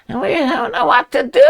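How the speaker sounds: high-pitched put-on voice of the clueless; mock helpless whine. Mocking Russians as lost in space, high helpless whine. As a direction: wail it high like someone hopelessly lost.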